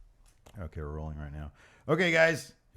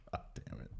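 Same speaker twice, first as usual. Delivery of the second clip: whispered; 13 dB quieter than usual. Real, not acted. Laughing under breath.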